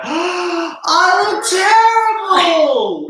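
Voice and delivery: high, drawn-out, sing-song wail; wailing despair. Retold or imitated. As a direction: wail it like a horrified viewer. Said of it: "and you go".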